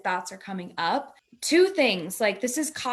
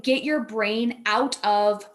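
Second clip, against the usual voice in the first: ordinary talk, nothing marked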